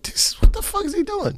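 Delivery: high incredulous squeak; mock bewildered question; baffled. Baffled thought in story.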